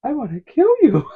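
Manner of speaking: put-on a little boy sweetly saying something dark; sugary little-boy threat, high-pitched; said real sweet. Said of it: "said real sweet".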